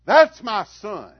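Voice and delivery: loud, high, stretched-out proud yell; proud holler. Retold or imitated. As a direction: shout it from the bleachers at a home run. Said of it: "I'd holler".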